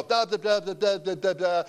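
mindless run of sing-song syllables; rattled-off recitation. Retold or imitated. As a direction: imitate someone rattling off a memorized verse. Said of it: "just rattle off the verse".

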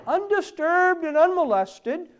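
very high, loud burst; emphatic preaching. Real, not acted. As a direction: ring the words out forcefully. Preacher mimics plotters' insistence.

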